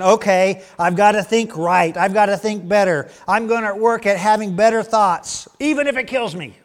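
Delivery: a striving believer psyching himself up; determined inner voice. Retold or imitated. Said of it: "You might be thinking".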